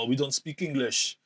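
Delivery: slow, lazy mock French accent; a light, slowed-down French accent, mocking the Globes. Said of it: "that was a French accent".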